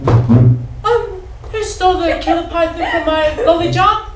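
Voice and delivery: high-pitched schoolteacher questioning the class; teacher's voice. Acted. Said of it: "my teacher asked the class".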